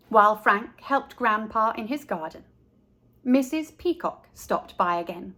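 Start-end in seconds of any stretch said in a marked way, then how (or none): none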